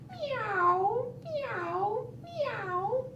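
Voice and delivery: high, rising kitten meows; bright kitten mewing. Acted. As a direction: mew back like kittens. Kittens answer mother.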